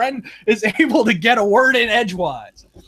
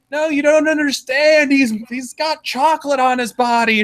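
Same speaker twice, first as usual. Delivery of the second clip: put-on protesting kid; 7 semitones higher than usual.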